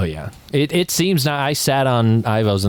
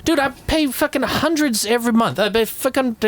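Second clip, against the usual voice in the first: angry rant; 14 semitones higher than usual. Real, not acted. Ranting about insurance.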